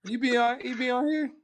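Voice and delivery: a silly squeaky voice asking questions; high put-on voice. Friend teasing about a guy online, squeaky put-on voice.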